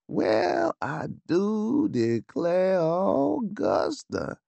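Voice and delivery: put-on slow, drawn-out Southern belle accent, high; exaggerated southern accent. A narrator's reading. Lucy teasing Augusta in a hammed-up Southern accent. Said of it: "said Lucy in an exaggerated southern accent".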